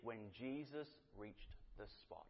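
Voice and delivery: "When Jesus reached the spot" slow and soft, weight on Jesus; slow hushed emphasis. Shown as a demonstration. Preacher stressing the verse's precision, slow and hushed. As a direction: linger over the verse in a hush. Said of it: "The Bible was so specific".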